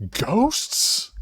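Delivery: high-pitched scream without much volume; mock scream. [name] heard from inside the shop, mock screaming. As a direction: cry it out high, holding back the force. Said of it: "[name] screaming the word".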